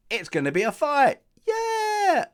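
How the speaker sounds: loud, high hyped-up shout; rising into a whooping mock cheer; mock hype. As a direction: cheer on the fight, hammed up. Mock hype as fight scene begins.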